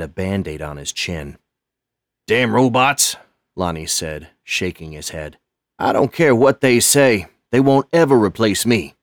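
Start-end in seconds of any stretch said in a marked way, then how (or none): 2.3-3.2 higher, louder gruff grumble at the robots; grumpy old custodian. Acted. 5.8-8.9 higher and louder, proud and stubborn; defiant old custodian. Acted. Lonnie's defiant voice.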